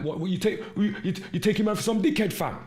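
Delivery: mock street-tough girl, high and loud; girls talking like boys. Imitating young girls speaking like little boys, high loud mock bravado. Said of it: "You can't have girls speaking like that".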